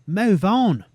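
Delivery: exasperated yell; frustrated outburst. Real, not acted. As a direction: bark it at the dawdling woman. Fed up with lingering NPC.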